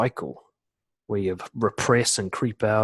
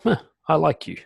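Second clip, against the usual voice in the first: higher, offhand little confession; casual, simple admission; 6 semitones higher than usual. Shown as a demonstration. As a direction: say it simply, as it pops up. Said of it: "I'd just say it like that".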